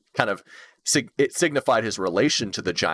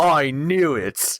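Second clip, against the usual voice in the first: Patches impression; 4 semitones higher, much slower than usual. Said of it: "you hear a voice off in the distance".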